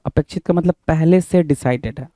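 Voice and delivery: ordinary delivery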